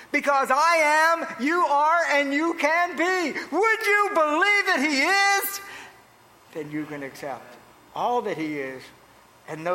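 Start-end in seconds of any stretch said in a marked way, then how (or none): 0.1-5.6 slow, raised preacher's cry building to a question; high, stretched-out pulpit delivery; impassioned preaching. Real, not acted. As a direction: preach it high, drawing out the words. Preacher at full force.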